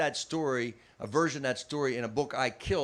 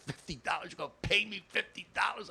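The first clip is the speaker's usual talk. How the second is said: amazed disbelief; 5 semitones higher, 5 dB quieter than usual. Retold or imitated. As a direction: pitch climbing in amazement. Amazed reaction to gig offer.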